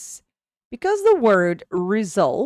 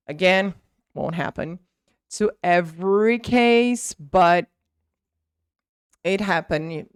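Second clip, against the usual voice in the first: ordinary delivery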